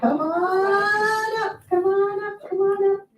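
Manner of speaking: drawn-out high call. Real, not acted. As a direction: call her up to the front slowly. Calling up next person.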